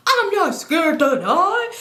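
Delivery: high, loud, drawn-out mock bravado; hammed-up slow shout; defiant yelling, imitated. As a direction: imitate him hollering defiantly. His acted defiant answer.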